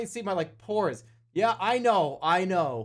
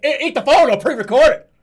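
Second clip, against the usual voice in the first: emphatic, louder demand; louder repeat; 3 semitones higher, 10 dB louder than usual. Real, not acted. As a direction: say it louder, insisting.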